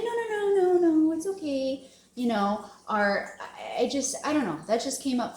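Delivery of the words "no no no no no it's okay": higher, hurried brush-off of a compliment; mock deflecting. Mimicking someone turning away a compliment, mock flustered. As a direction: play someone waving off praise. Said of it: "You don't have to turn them away, say".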